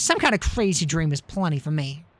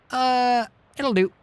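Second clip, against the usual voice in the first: normal talking voice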